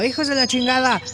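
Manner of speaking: high-pitched mock scolding yell; mother yelling. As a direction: yell like a fed-up cartoon mom. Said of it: "llegó su mamá gritando".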